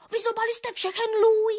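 high, indignant bird voice; angry accusation. A narrator's reading. Nuthatch blames the tits for eaten fat, angry high voice. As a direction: accuse them, voice pitched right up. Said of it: "zlobil se Brhlík".

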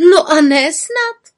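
slow indignant little witch retort. A narrator's reading. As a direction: bristle at the doubt. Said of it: "zvolala pravá malá čarodějnice".

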